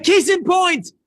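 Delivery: loud, high-pitched proclamation; emphatic exclamation. Real, not acted. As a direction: call it out to the whole room. Speaker hammers home his point.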